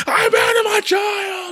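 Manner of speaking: anguished shout; mock anguished shout. Shown as a demonstration. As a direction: scream "abandoned" like a breaking man. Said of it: "It's not serious".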